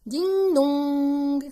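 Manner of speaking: high, loud sung chime; imitated doorbell. Narrator rings for the arriving guests, chiming doorbell. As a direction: ring out two long bell notes.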